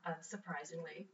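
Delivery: normal talking voice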